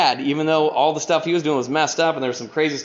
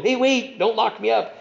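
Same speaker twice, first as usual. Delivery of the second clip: higher, half-hearted plea to the judge; mock pleading; 9 semitones higher than usual. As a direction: beg lightly, tongue in cheek. Imitating himself before judge.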